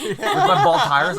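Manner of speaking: high loud laughing words. Real, not acted. Laughing at his own bald-tire chase, high and loud. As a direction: laugh it out slowly.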